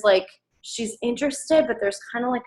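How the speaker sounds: ordinary talk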